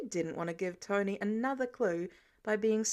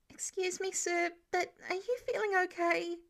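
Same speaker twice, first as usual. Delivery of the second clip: higher, innocently worried; feigned concern; 8 semitones higher than usual. Acted. Peter to Tony, faking concern for his health. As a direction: play dumb with fake concern. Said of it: "appropriately concerned".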